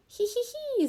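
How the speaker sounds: very high hee-hee-hee; tickled giggle. A narrator's reading. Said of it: "засміявся Расмус".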